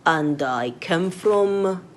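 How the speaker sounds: French spoken with a heavy English accent; wrong French accent. Shown as a demonstration. Teacher mocks a learner's wrong French accent. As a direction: demo the bad accent a learner has. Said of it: "how does that sound? Funny, right?".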